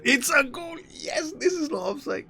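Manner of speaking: very high, shrill-free cheering yell; excited goal yell. Real, not acted. Manager watching his side score, ecstatic high yell. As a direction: scream the goal in delight, way up high.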